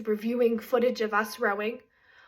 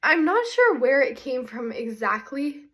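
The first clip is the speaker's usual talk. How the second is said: ordinary talk, nothing marked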